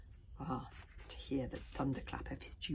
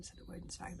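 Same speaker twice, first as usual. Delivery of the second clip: plain reading voice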